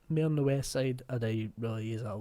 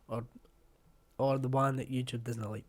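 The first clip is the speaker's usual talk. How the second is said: normal talking voice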